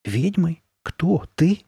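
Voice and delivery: high, incredulous questions; astonished. A narrator's reading. Girl amazed at her friend's witch costume idea, astonished. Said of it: "У Сабрины от удивления даже рот приоткрылся".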